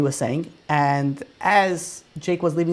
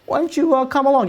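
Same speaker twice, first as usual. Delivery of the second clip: coaxing invitation; 9 semitones higher than usual. Retold or imitated. Rabbi coaxing the young man into coming along, higher and eager. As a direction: voice jumps much higher to coax.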